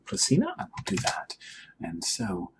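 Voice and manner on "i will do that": put-on breathy British-accent whisper. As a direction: breathe it out under your breath. Player in character, quiet aside.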